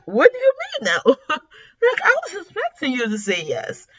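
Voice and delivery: high-pitched disbelief breaking into laughter; mock incredulous protest. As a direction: imitate an indignant man, laughing. Acts out men shocked by refusal.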